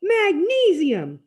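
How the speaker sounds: high, loud, drawn-out cry; slow, high-pitched yell; exultant shout. A narrator's reading. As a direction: call it out slowly to the sky. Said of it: "he shouts".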